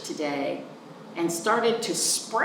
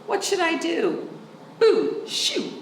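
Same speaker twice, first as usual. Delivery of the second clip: shooing; 10 semitones higher than usual. Acted. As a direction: chase the raccoon off. Child shooing raccoon.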